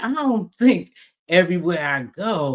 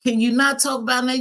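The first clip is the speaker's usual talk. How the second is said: exasperated; 7 semitones higher, 3 dB louder than usual. Retold or imitated. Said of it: "and I'm like".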